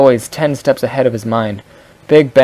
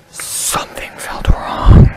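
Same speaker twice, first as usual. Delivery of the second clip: slow, unvoiced sexy whisper; slower than usual. Shown as a demonstration. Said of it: "That was my sexy voice again".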